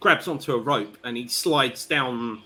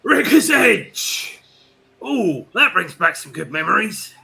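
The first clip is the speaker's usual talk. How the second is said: high, drawn-out lookout's shout, easing into a raised voice; 6 semitones higher, 8 dB louder than usual. Acted. Flynn shouts down from the mast to his crewmates, shouting. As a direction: call down loudly from the mast. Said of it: "he shouts down to you".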